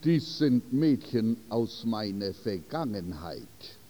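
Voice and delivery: understated sorrow in the answer; sad. A narrator's reading. Groom explaining the girls to Esther, mildly sad. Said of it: "antwortete er traurig".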